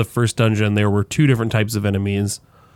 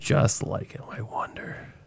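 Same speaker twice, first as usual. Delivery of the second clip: soft conspiratorial whisper; 11 dB quieter, slower than usual. Pretend. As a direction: whisper it like a secret. Conspiratorial joke about same character.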